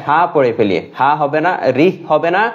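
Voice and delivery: ordinary talk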